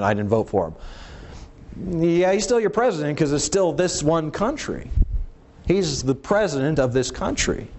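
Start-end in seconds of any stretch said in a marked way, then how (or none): 0.0-2.0 slow, low, sulky mumble of a sore loser; sulky mock voter. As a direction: drag it out like a grumpy voter. Same quote, mocked slowly.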